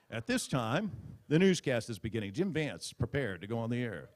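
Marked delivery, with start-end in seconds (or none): none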